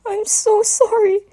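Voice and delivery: high, slow, tearful little dragon voice; broken weeping plea; sobbing apology. A narrator's reading. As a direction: cry the words out like a sobbing child. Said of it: "his sobs coming out muffled".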